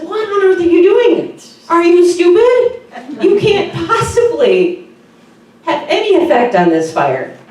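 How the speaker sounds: high, loud, mocking owl voice; a high loud scornful owl, laughing at the little bird; scolding owl. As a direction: scoff at the bird as the incredulous owl.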